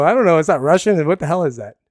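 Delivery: loud, high, baffled questioning; puzzled listener. Acted. Listener puzzling over the host's accent, loud bafflement. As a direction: blurt it out loud, trying to place the accent. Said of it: "And then you go".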